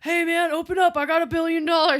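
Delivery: loud, high imitation of yelling; shouting through a door. Friend pounding on the door, excited mock shout. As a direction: holler it excitedly to someone inside.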